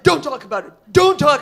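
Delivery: forceful order, building to loud; urgent warning. Retold or imitated. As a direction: cut the question off hard. Colleague orders silence, second louder.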